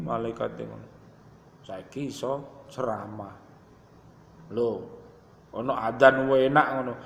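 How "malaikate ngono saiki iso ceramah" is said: usual speaking voice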